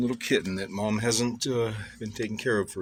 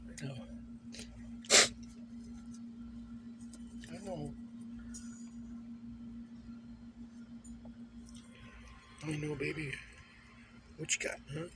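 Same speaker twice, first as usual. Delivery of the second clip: soft cooing; 10 semitones higher, 12 dB quieter than usual. Real, not acted.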